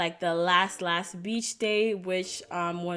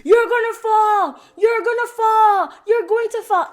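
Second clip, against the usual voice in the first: high, loud shouted warning; hammed-up repeated holler; imitated yell; 14 semitones higher, 10 dB louder than usual. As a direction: act out a climber yelling up at you. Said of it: "who started to yell".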